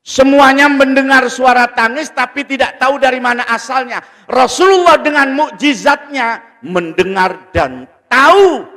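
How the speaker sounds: high, loud sermon cry; impassioned preaching. Real, not acted. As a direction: raise the voice and stir the congregation. Preaches at full force. (ceramah berapi-api, suara tinggi dan lantang)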